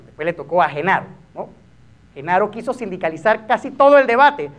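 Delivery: normal talking voice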